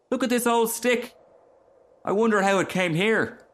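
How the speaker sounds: put-on high, curious child; little boy's voice. A narrator's reading. Boy finding an old stick, high and curious. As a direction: wonder aloud like a small boy. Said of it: "cried one of the boys".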